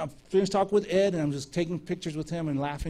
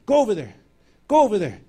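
louder, higher mock command; insistent urging; 4 semitones higher, 7 dB louder, faster than usual. Mom pushing her cousin toward the preacher, insistent. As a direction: push someone along with two firm, raised orders.

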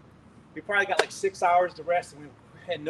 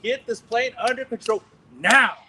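loud, slow commanding roar; 4 semitones higher, 7 dB louder than usual. Retold or imitated. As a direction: roar the order at the plane. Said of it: "my roar sounds stupid".

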